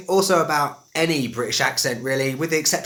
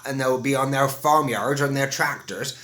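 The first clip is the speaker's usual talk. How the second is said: put-on West Country accent. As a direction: put on a rural southwest English accent. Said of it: "you might go down the southwest".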